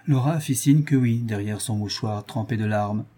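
usual speaking voice

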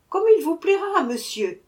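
bright high cheerful answer; cheerful reply. A narrator's reading. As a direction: answer gladly. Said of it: "répondit joyeusement".

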